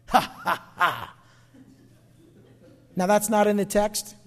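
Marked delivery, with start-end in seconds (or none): 0.1-1.1 low scornful chuckle; ha ha ha, laughing death off; mocking laugh. Preacher laughing at death on God's behalf, scornful chuckle. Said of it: "that little bit of laugh afterward".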